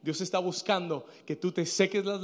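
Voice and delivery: low, soft, sober admonition; quiet grave preaching. Acted. Preacher telling congregation God seeks faith, not tears, quiet and grave. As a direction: bring it right down and say it gravely. Preacher drops after climax.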